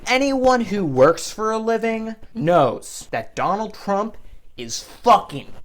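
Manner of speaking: building angry declaration; heated rant. Retold or imitated. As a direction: boomer fisherman sounding off on politics. Fisherman ranting about Trump.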